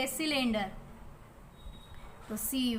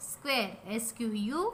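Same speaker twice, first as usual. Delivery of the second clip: 1 slow spelling; much slower than usual. Shown as a demonstration. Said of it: "Which shape is this?".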